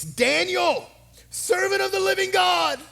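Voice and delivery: high, loud, drawn-out anguished calling; anguished cry. A narrator's reading. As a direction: cry out in anguish to a friend you fear is dead. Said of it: "he called out to Daniel in an anguished voice".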